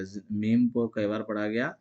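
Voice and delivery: ordinary talk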